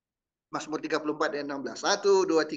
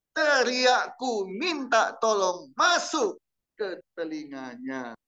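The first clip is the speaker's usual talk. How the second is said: slow group scripture reading; 3 semitones higher, slower than usual. Acted. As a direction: read it out together, steady and strong. Congregation reads verse aloud together.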